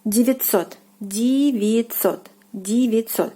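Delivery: девятьсот said "dee-vee-TSOT", vowels as E and ть as ts. Shown as a demonstration. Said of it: "first two vowels are pronounced like E".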